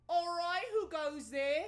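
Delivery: loud, high challenge from the wall; guard's shout. Acted. Night guard shouting down at the intruders, loud and high. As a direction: call down from the tower like a guard. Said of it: "he goes".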